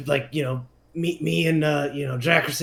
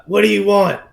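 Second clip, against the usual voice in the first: raised, louder demand; firm, high confrontational question; loud challenge; 5 semitones higher, 7 dB louder than usual. Acted. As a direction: call out to an approaching stranger. Player challenges the silent approaching figure.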